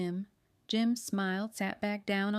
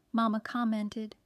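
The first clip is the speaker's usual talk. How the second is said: ordinary delivery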